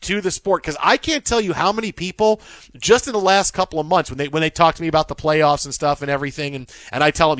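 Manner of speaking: usual speaking voice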